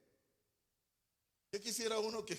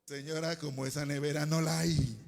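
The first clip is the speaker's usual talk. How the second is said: loud, lower-pitched salesman line; merchant's sales pitch; 4 semitones lower, 8 dB louder than usual. Acted. Merchant answers a customer about a fridge, loud sales pitch. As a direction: sell it loudly, holding the last word.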